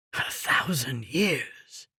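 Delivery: a soft, slow stunned whisper. A narrator's reading. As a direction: breathe it out softly and slowly, in disbelief. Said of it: "he whispered".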